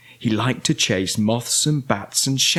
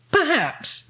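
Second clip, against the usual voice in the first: high, wondering character voice; pondering witch; 13 semitones higher than usual. A narrator's reading. Winnie studies the pile of metal, high pondering voice. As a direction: lift it high, idea forming.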